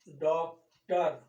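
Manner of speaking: Doctor drawn out very slowly; slow demo word. Shown as a demonstration. Teacher giving students a loanword example, very slow. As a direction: spell out doctor slowly for a class. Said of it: "आपने लिखा ऐसे".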